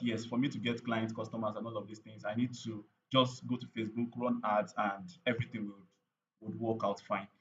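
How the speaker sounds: usual speaking voice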